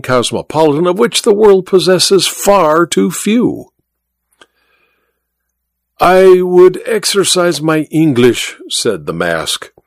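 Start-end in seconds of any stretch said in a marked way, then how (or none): 6.0-8.6 a hint of French accent, higher; careful English with a touch of French; light French accent. A narrator's reading. The French magician practising English on Godal, light French accent.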